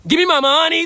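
rally the whole bar; all-out hype shout. Retold or imitated. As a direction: shout the trend line at full blast. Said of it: "I'm like".

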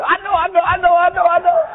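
high, rapid-fire repeated outburst; eager Peter. Retold or imitated. Peter jumping in to answer Jesus, eager outburst. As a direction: burst in like an overeager pupil.

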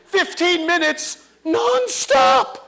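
usual speaking voice

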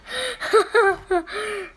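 high shrieking giggle; squealing laugh. Real, not acted. Laughing hard while spinning.

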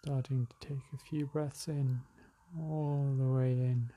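calm, unhurried meditation guide; spacious, settled relaxation-exercise delivery; guided meditation. Acted. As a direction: ease them into slowing the breath, with long pauses.

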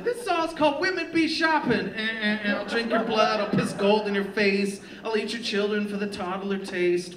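high, loud mock frontman ranting; hammed-up stage shout. Fake frontman announcing horrible songs to a Nashville crowd, mock shouting. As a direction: belt it out like a ridiculous band singer.

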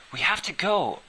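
panicked shout to leave now; urgent shout. Acted. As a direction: yell a warning over the storm. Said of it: "I shouted".

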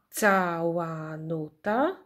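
"Cała nuta" very slow, each syllable held. Shown as a demonstration. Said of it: "ilość sylab w nazwie pokrywa się".

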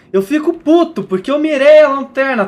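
loud, high angry outburst; venting at the buggy game, furious; angry rant. Real, not acted. (desabafo irritado e alto)

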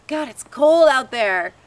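loud, high, drawn-out complaint; retold protest. Woman recalls not wanting to walk, protesting. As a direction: balk at the cold, stretching it out. Acting out her reluctant thought.

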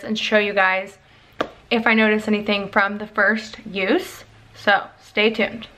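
talking around teeth strips; whitening strips on teeth. Real, not acted. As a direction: lips careful over strips. Seen 2: Teeth strips applied before; still on while talking, mouth shows teeth.

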